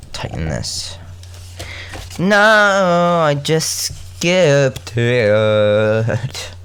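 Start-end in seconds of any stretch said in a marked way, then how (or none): 2.2-6.5 exaggerated long whine of dismay; dismayed wail. Real, not acted. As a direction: wail it slowly, stretching every word. Dismay at losing their place.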